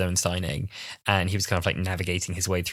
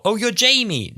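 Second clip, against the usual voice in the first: high, loud mock recognition; excited recognition; 13 semitones higher, 5 dB louder than usual. Retelling fans guessing the costume, excited. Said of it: "Or they were like".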